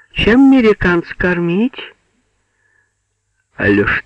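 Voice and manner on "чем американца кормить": high, far-carrying calling voice; woman calling through from the next room; thin female voice. A narrator's reading. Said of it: "позвал тонкий женский голос". (тонкий женский голос)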